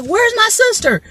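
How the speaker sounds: high, panicked calls for his family; frantic brother. Retold or imitated. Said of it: "he comes running up the street and he's like".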